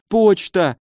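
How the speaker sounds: high-pitched, pained wailing; panicked injured baron. A narrator's reading. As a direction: plead in high, agonised bewilderment. (высокий страдальческий голос перепуганного барона)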